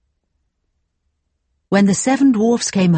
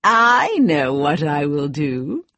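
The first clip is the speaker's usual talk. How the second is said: slow, scheming villain; plotting aloud, low and slow; evil queen; 4 semitones lower, slower than usual. Acted. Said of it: "and then she said".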